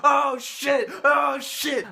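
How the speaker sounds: high, loud, thrilled yell; excited shout. Retold or imitated. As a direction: shout it in wild disbelief. Friends freaking out, cheering.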